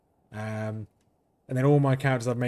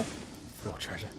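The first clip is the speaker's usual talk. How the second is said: whispered; 3 semitones lower, 12 dB quieter, much slower than usual. Real, not acted. Whispered repeat of a phrase.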